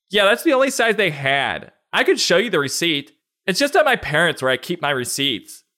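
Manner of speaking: high, put-on excuse-making voice; defensive borrower. Friend explaining away borrowed boots, high and defensive.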